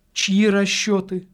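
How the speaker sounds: high, insistent demand; impatient passion. A narrator's reading. Lady demanding answers from the priest, impatiently passionate. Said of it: "с нетерпеливой страстностью".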